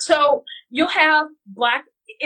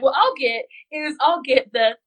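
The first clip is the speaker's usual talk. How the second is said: normal talking voice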